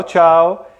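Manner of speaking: ordinary talk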